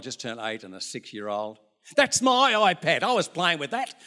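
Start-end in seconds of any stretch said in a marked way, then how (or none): 2.0-3.9 put-on high, whiny child protesting; squabbling grandkid. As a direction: play the kid who wants the iPad back.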